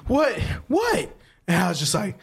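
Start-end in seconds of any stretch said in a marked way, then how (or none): 0.1-1.1 imitated panting, then high-pitched yelps; mock-frantic cries jumping way up; frantic panic. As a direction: act out a breathless, panicked friend. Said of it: "opens the door in a frantic panic".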